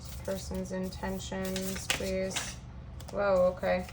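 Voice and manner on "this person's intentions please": soft murmured question to the cards; quiet murmur. Real, not acted. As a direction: murmur it under your breath. Asking the cards while shuffling.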